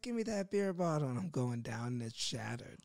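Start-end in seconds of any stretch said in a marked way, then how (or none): 0.0-1.1 high, eager challenge; snatch the bottle, daring them; cocky dare. Retold or imitated. To doubting friends, a cocky high-pitched dare.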